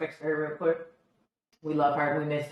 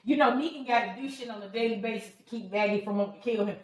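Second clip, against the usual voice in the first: ordinary talk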